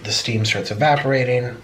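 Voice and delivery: ordinary delivery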